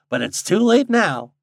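high, loud teasing rustic; lightly amused backwoods voice; chuckling old guide. A narrator's reading. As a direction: needle him with mild country glee.

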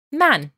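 Man said high and clearly, as a model; Persian word. Shown as a demonstration. Teacher models the Persian word for students. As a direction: model Man crisply for learners. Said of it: "means me".

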